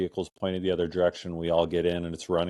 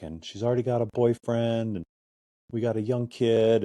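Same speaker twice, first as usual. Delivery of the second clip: higher, understated retelling of someone's woes; depressed, upset man; 5 semitones higher than usual. Retold or imitated. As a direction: mildly imitate a dejected man, higher-pitched. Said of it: "just depressed and crying".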